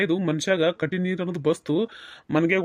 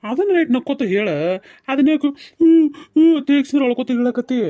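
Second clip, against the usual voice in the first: much higher and louder, teasing scold; mock scolding; 7 semitones higher, 6 dB louder than usual. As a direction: chide her playfully. Husband scolds her crying yes. (ಹುಸಿ ಗದರಿಕೆಯ ಜೋರು ದನಿ)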